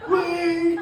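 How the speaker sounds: high-pitched mock yell; acted high holler; calling after a car. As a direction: yell after someone getting away, drawn out and high. Ladies chasing a car, calling out.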